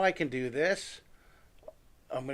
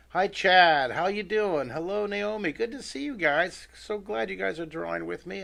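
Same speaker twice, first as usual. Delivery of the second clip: cheerful greeting; 6 semitones higher, 5 dB louder than usual. Real, not acted. Greeting chat viewers.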